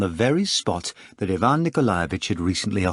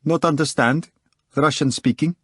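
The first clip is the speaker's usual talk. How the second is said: curt, broken foreigner's English, slightly higher; unpleasant foreign accent; 4 semitones higher than usual. A narrator's reading. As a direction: play dumb in a stiff foreign accent. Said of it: "answered unpleasantly".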